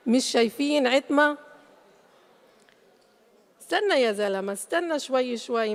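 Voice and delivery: usual speaking voice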